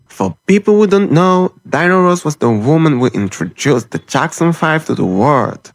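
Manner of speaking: ordinary talk, nothing marked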